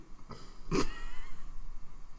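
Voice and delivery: high breathy burst of laughter. Real, not acted. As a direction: crack up at your own joke. Laughs at own buzzer joke.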